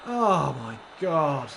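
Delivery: slow, loud drawn-out oh my god; exasperated groan. Real, not acted. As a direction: let the frustration drag it out. Stream stressing him out.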